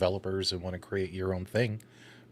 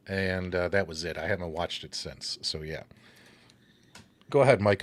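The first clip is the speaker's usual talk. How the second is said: catching his breath between words; winded, short on air; 4 semitones lower than usual. Real, not acted. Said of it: "I'm trying to catch my breath here".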